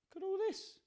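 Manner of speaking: high, soft delighted aside; excited squeal. Real, not acted. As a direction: coo at the mess.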